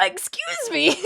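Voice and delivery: high, loud, laughing "excuse me"; mock indignant. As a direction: act scandalised through a laugh. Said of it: "I'm like".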